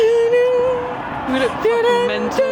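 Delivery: tipsy laughing shout. Real, not acted.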